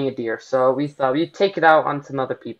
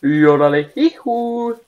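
slow mock-German yodel, rising higher; mock German accent; 4 semitones higher, slower than usual. Player clowning for the viewers, mock German yodel. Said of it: "in that kind of voice".

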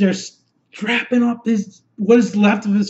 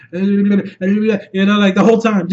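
normal talking voice

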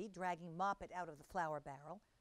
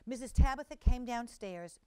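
ordinary delivery

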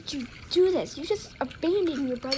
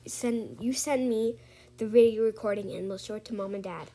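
ordinary talk

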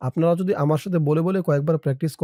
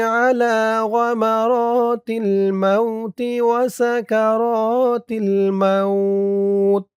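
slow, melodic Arabic chant; Quranic-style recitation; 6 semitones higher, much slower than usual. Acted. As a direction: recite the dua like tilawat, unhurried.

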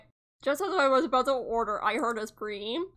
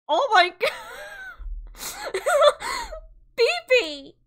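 real laughing scream; screaming laughter; 5 dB louder than usual. Real, not acted. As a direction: shriek with laughter, much higher and louder. Reacting to game, laughing screaming.

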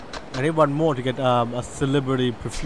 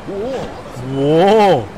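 surprised; 3 semitones higher, 6 dB louder than usual. Real, not acted.

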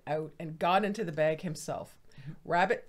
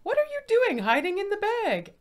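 high, quick fox voice; pry at the bag, much higher and faster; curious fox; 14 semitones higher, 5 dB louder, faster than usual. Acted. Fox questioning rabbit.

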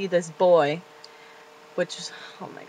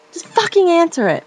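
demanding; 10 semitones higher, 7 dB louder than usual. Real, not acted. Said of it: "demanding viewers answer".

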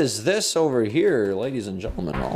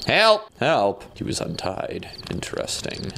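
ordinary delivery

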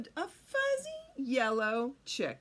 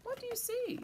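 plain reading voice

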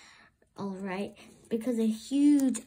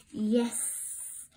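hissed. A narrator's reading. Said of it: "hissed Flotsam".

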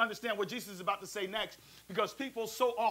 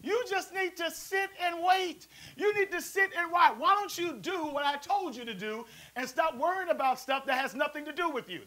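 higher scolding parent voice; mock stern parent, a touch higher; 7 semitones higher than usual. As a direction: scold like a fed-up mama. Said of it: "my parents be like".

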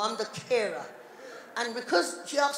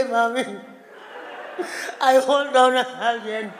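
mock crying; slower than usual. Daughter crying to mum about falling, mock tearful. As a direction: act out crying while talking. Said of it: "I wanted to cry and talk".

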